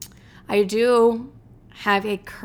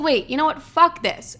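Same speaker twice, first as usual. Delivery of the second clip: higher-pitched, sudden defiant decision; retold fed-up outburst; 6 semitones higher, 3 dB louder than usual. Telling a friend how she decided to pay, fed up. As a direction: blurt it out, pitched up and indignant.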